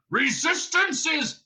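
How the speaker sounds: high, slow, full-lunged bellow; Vogon bellow. A narrator's reading. Guard showing off his favourite shouting, bellowing. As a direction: fill your lungs and roar it, stretched. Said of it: "He filled his lungs and bellowed".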